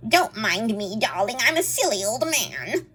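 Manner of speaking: put-on lightly squeaky old man voice; mock a narrator's silly old man; bad old man voice. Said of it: "the way this woman does an old man voice".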